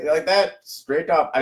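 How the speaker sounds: ordinary talk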